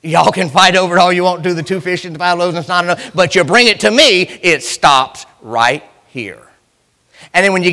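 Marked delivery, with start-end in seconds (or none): 3.1-6.4 slow drawn-out preacher cadence; preaching refrain. Acted. Preacher to congregation, slow emphatic refrain. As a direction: hammer the refrain home slowly.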